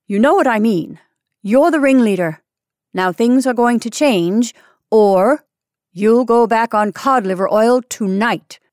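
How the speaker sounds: loud, emphatic telling-off; stern warning. A narrator's reading. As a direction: lay down the law to a troublemaker. Senior threatens the ringleader.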